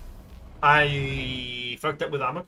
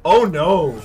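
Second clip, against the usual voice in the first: sudden loud "oh no"; loud dismay; 5 semitones higher, 15 dB louder than usual. Real, not acted. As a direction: blurt it out in shock. Unit dies unexpectedly.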